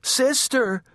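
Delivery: high, urgent call; shouting for sister. A narrator's reading. Baby calling her older sister, shouting in fright. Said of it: "shouted Baby".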